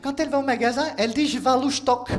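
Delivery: high put-on voice of the girlfriend; imitating girlfriend. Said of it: "elle me disait". (imitation de la copine, voix plus aiguë)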